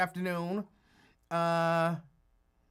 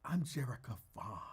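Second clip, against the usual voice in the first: lightly voiced fancy woman, lower and softer; a hint of bougie self-introduction; Jerica Vaughn, fancy; 6 semitones lower, 8 dB quieter than usual. Host imagining her introducing herself, lightly imitated fancy voice. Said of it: "just thinking of her voice".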